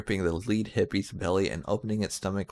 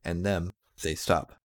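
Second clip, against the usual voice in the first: ordinary delivery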